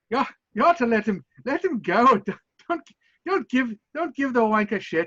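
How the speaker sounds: put-on Scottish accent. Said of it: "get a Scottish accent going".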